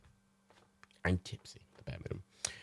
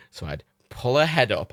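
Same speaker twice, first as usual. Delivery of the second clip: ordinary talk, nothing marked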